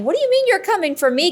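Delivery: high, fast indignant retort; retold angry challenge. Medium answering back at the spirit, angry. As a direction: re-enact bristling disbelief, quick and high. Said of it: "I'm starting to feel angry".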